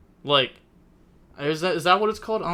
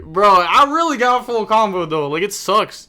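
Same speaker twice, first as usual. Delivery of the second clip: loud, higher, exasperated insistence; indignant protest; 6 semitones higher, 8 dB louder than usual. Real, not acted. Streamer insists to teasing chatter, loud exasperated protest. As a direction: insist it really happened, bro.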